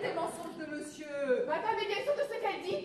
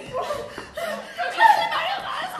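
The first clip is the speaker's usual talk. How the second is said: mock wail; 9 semitones higher, 9 dB louder, much slower than usual. Lamentation comique.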